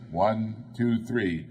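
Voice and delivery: usual speaking voice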